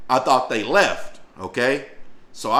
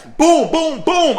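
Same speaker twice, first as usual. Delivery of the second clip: high loud boom for each blow; mimicking punches during the fight; punch sound effects; 7 dB louder, much slower than usual. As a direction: hit every boom like a punch. Acting out punches landing.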